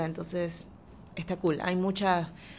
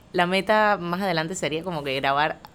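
normal talking voice

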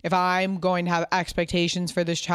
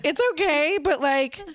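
higher-pitched mock patience; joking exasperation; 9 semitones higher than usual. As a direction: retell your exasperated reply as a joke. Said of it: "a funny way".

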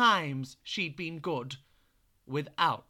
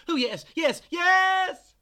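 rising cackly shout, much higher and louder at the end; witches shouting back; 13 semitones higher, 9 dB louder, slower than usual. Acted. Witches answering their leader, excited shout. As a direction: crowd of witches roaring yes. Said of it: "shouted back all the witches".

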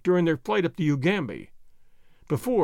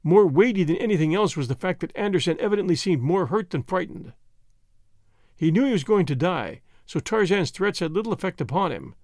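ordinary delivery

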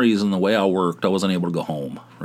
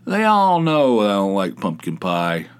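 ordinary talk

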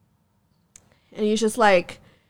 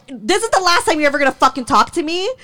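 fast, insistent retold demand; starts high, races on angrily; heated ultimatum; 4 semitones higher, 4 dB louder, faster than usual. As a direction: rattle off an ultimatum. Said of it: "I'm already just, like, just heated".

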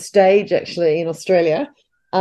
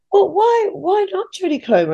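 a light imitation of a polite British gentleman, pitched higher; mock-genteel, courteous English gent asking; gentlemanly voice; 11 semitones higher than usual. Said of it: "in his very gentlemanly voice".

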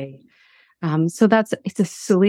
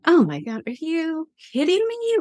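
frustrated; 8 semitones higher, 3 dB quieter than usual. Retold or imitated. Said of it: "And I'm frustrated".

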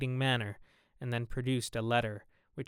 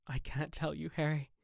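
soft, sorrowful refusal; sadly; 4 semitones higher, 3 dB quieter than usual. A narrator's reading. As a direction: hold back sad and hushed. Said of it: "he answered sadly".

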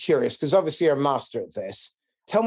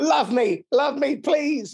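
mock needy plea; 11 semitones higher, much slower than usual. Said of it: "That was me being passively needy".

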